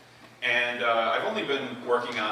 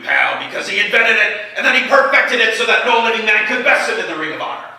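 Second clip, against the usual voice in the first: higher and loud, a barking American drill-sergeant voice; Soldier; 8 semitones higher, 11 dB louder than usual. Acted. An army officer lecturing a recruit about Sun Tzu, shouting. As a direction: bellow it like a gung-ho general lecturing a recruit. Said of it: "the soldier".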